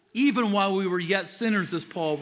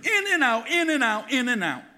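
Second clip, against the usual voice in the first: emphatic repetition; 5 semitones higher, 4 dB louder than usual. Real, not acted. Mocking falling away again.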